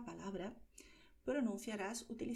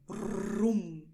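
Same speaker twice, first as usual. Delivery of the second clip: put-on loud child's brum with a rolled r; thunder noise; 9 dB louder than usual. Shown as a demonstration. Teacher imitating a child making thunder, loud brum. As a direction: rumble it out like a kid playing thunder. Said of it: "el niño está haciendo el ruido del trueno".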